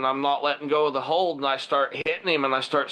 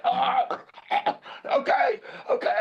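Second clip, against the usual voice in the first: high, strained imitation of being choked; choked surrender; 7 semitones higher, slower than usual. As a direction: squeak out surrender through a squeezed throat. Acting the bully being choked.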